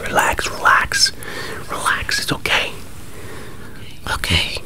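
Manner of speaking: ordinary talk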